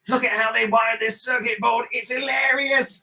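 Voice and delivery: put-on high, odd comedian voice; Richard Ayoade. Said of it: "do your Richard Ayoade".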